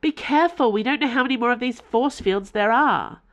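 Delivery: urgent warning. Acted. Warning friend while running.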